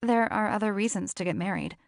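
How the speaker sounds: ordinary talk, nothing marked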